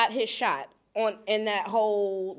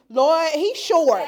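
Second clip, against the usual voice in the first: louder, slow, mock despair at his height; dismayed groan; 7 semitones higher, 9 dB louder, slower than usual. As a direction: drag it out, disappointed. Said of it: "Met him, and I was like".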